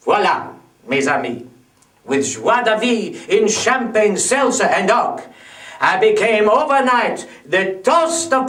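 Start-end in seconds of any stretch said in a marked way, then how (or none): none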